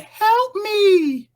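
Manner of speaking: put-on high, loud child's cry of help me; piercing high child's voice; little kid crying for help. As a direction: call out like a frightened little kid. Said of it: "she keeps on hearing it".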